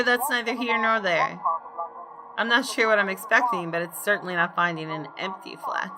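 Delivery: ordinary talk, nothing marked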